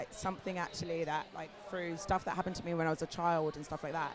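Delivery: ordinary talk, nothing marked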